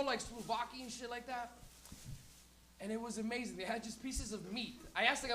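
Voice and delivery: usual speaking voice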